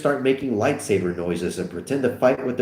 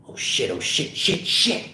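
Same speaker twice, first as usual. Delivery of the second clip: breathy panic, rising at the end; frantic, half-voiced cursing; panicked muttering; 5 semitones higher than usual. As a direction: repeat it in mounting panic. Acted panic after car crash.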